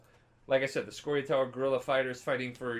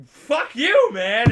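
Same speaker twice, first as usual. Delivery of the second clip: much higher, loud hammed-up shout; furious yell back; 8 semitones higher, 12 dB louder, much slower than usual. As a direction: scream it back at him. Roche yells back at Yorveth.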